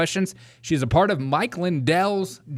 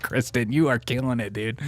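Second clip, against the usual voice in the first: ordinary delivery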